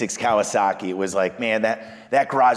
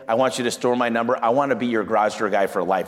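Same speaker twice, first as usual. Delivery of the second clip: normal talking voice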